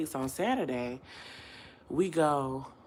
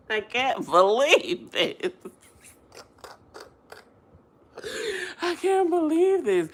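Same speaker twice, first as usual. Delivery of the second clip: tearful disbelief; 11 semitones higher, 4 dB louder than usual. Real, not acted. Disbelief over lost man.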